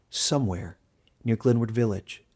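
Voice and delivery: ordinary delivery, nothing marked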